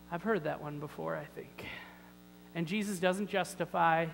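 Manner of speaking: ordinary talk, nothing marked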